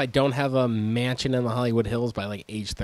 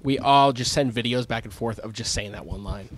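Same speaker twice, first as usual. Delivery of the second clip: usual speaking voice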